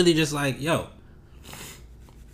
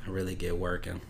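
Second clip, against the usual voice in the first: usual speaking voice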